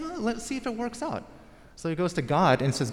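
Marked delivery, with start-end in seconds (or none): 0.0-1.2 light Abraham voice. Retold or imitated. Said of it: "he's like".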